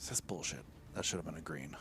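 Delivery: soft unvoiced grumble; whispered frustrated swearing; whispered curse. Real, not acted. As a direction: hiss it under your breath.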